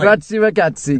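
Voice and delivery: cohost prompting the Italian accent. Shown as a demonstration. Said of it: "do your Italian accent".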